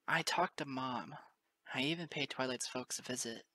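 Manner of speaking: usual speaking voice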